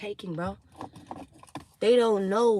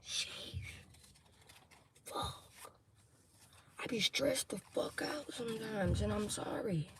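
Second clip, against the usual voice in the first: soft whispered confession; stressed whisper; 14 dB quieter than usual. Real, not acted. Reader quietly confessing stress to her viewers. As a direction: breathe it out under pressure.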